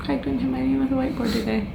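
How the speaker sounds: soft, fast, relieved little thought; quiet mock inner voice. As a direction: murmur it to yourself, quickly pleased. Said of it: "Every day I'm like".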